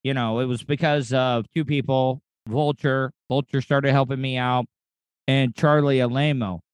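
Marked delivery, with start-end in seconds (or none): none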